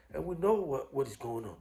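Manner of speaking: ordinary talk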